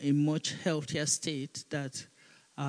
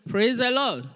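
laughing praise; 6 semitones higher, 4 dB louder than usual. Real, not acted. Pastor to the church, praising through laughter.

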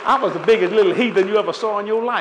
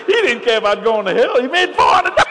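high, loud laughing through the words; high delighted laughing retelling; laughing hard; 10 semitones higher, 7 dB louder than usual. Real, not acted. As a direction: crack up telling it. Laughs at his daddy's greed.

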